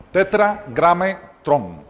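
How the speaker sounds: Tetragrametrón drawn out syllable by syllable; slow term. Shown as a demonstration. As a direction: spell Tetragrametrón out slowly for the room. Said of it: "reading the term for the congregation".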